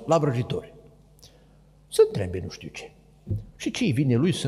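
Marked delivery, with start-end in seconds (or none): none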